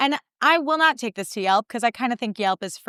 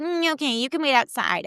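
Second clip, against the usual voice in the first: higher, curt hostess voice; snippy hostess; 5 semitones higher than usual. Retold or imitated. Hostess with a bee in her bonnet, snippy and higher. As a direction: give them attitude. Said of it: "There was a bee in this girl's bonnet".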